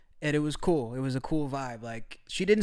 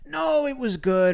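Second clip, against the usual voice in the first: higher, slow coddling reassurance; mock reassurance; 7 semitones higher, 3 dB louder, slower than usual. Mocking Western viewers reassuring him, slow and higher. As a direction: soothe like an overly nice Westerner. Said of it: "People always from the West".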